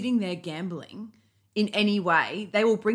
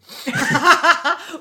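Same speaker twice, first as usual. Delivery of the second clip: laugh; 8 semitones higher, 9 dB louder than usual. Real, not acted. Listener bursting out laughing at pontoon.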